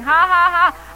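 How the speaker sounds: loud, deliberate ha-ha laugh. Shown as a demonstration. Preacher showing how a believer laughs by faith, loud laughing. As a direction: laugh boldly on purpose, syllable by syllable. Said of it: "A believer starts laughing".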